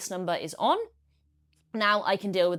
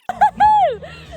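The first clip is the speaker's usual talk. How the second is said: excited laugh; 12 dB louder than usual. Real, not acted. Excited outdoor transition vlog.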